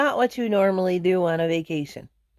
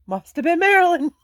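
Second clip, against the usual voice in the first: joking quip; 9 semitones higher, 5 dB louder than usual. Retold or imitated. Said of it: "phantom fart".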